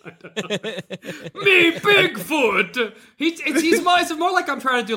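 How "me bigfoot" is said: slow monster declaration; Frankenstein-like Bigfoot. Shown as a demonstration. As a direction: announce yourself like a movie monster. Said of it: "like, a Frankenstein".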